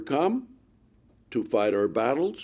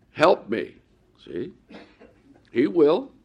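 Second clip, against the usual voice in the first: normal talking voice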